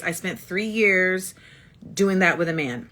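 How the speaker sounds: ordinary delivery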